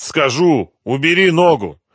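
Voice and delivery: high, loud desperate plea; strained surrender. A narrator's reading. Captive begging gangster to lift his foot, strained. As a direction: give in and beg loudly. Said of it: "выдавил артист".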